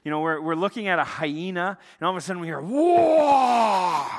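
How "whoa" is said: loud, long high roar falling away; imitated lion roar. Preacher imitating what they heard in the dark, sudden roar.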